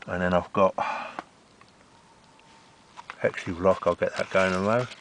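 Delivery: talking round a torch held in mouth; muffled, something in mouth. Real, not acted. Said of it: "I've got torch in my mouth".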